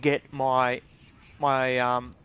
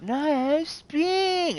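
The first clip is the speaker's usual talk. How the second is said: much higher insistent mock voice; mocking Americans; 13 semitones higher, 4 dB louder than usual. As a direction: parody an American, squeaky and insistent. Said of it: "all the Americans are like".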